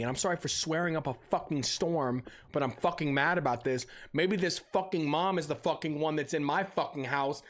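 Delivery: ordinary delivery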